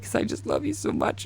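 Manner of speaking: high, soft, imitated weepy voice; mock tearful. Mom tells her son why she cries, imitated tearful voice. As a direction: squeak it out softly, as if crying. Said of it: "I just want you to know I'm crying".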